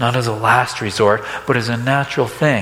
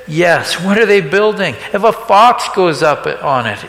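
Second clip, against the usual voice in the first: higher and louder, dripping sarcasm; sarcastic sneer; 6 semitones higher, 5 dB louder than usual. Retold or imitated. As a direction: scoff at the builders' efforts.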